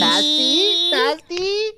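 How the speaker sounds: high silly "Pasty?"; mock high pasty. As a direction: tease the word up high. Mocking pronunciation.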